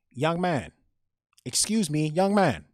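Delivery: put-on high, loud elderly Chinese woman's accent. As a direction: call out as an older Chinese woman.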